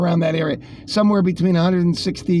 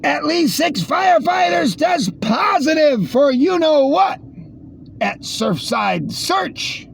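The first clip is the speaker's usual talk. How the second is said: mocking high voice; 9 semitones higher, slower than usual. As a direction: read it like a shrill mock alarm. Mocking headline read.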